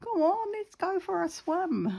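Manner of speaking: put-on high, whiny kid begging; high childlike pleading for a swim; pleading child voice. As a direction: beg like an excited child, way up high. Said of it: "bugging my mum and dad".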